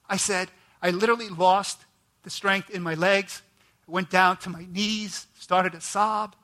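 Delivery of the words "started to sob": ordinary delivery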